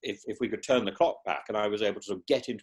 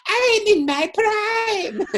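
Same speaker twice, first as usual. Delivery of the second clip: much higher, very slow, grand proclaiming; Miss Jean Brodie; 5 dB louder, much slower than usual. Retold or imitated. Guest imitates Maggie Smith's famous line, slow and lofty. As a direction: savour each word like a vain schoolmistress. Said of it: "where she is it".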